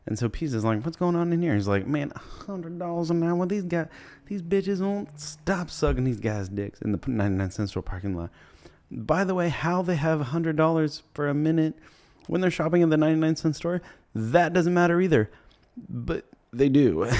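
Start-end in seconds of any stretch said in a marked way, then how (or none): 0.8-1.5 high-pitched startled question; surprised. Retold or imitated. Peace walks up surprised. 1.9-8.3 high, put-on voice of a giddy hustler; bragging hustler. Slider bragging to Peace. 8.9-17.1 high, disbelieving comic voice; comic aside in a high voice; incredulous aside. Acted.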